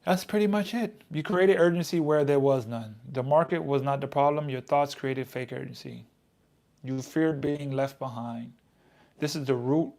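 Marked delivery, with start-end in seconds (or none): none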